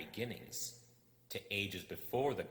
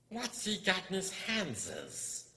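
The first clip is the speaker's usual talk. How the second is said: much higher, slow creature voice; Gollum hissing; 13 semitones higher, 4 dB louder, slower than usual. Acted. Gollum eyeing the dagger, slow and curious. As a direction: creep it out slowly.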